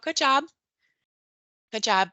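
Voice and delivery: a quick call of praise across the playground. Retold or imitated. Teacher calls to a child climbing, a light called-out praise. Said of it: "the teacher calls out".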